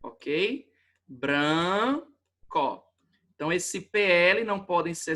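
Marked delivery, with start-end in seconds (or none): 1.1-2.8 "Branco" split into a long bran, pause, co; separação em sílabas. Shown as a demonstration. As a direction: hold the first syllable of "Branco", then pause. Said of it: "quando eu for separar". ("Branco" separado em sílabas: bran... co)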